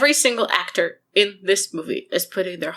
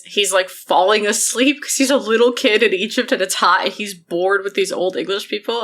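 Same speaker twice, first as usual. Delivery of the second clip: ordinary delivery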